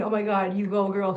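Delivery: normal talking voice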